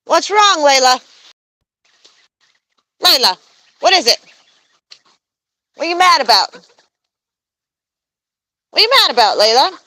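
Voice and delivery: high coaxing voice to a bird; pet-talk voice. Real, not acted. Asking the goose Layla what's wrong, high coaxing.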